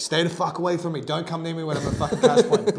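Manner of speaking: loud, high mock blow-up; imitating an angry outburst. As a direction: act out warning a stranger off, loudly. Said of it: "Blew up at him".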